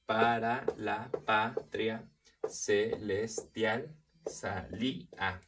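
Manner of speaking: very slow, every syllable set apart; syllable-by-syllable scansion. Shown as a demonstration. Teacher counting the syllables of a verse, slow scansion. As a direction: break the verse into separate, measured syllables.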